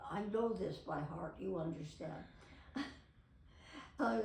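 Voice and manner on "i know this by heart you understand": ordinary talk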